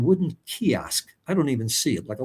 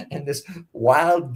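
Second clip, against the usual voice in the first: ordinary talk, nothing marked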